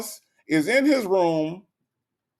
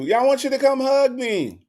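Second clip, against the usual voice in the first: needy mother; 7 semitones higher than usual. Acted. Mother voiced asking for hug.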